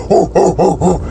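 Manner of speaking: repeated high "woo"s; mock cheering; imitated crowd whooping. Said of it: "they're still going".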